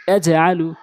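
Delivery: syllables joined into one word; quick reading. Shown as a demonstration. Teacher showing learners the joined reading, quickly. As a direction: read it briskly in one go. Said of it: "দ্রুতটা উচ্চারণ করতে হবে".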